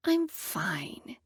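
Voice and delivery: whispered self-reassurance. Acted. Nervous girl reassuring herself, soft whisper. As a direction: breathe it to yourself.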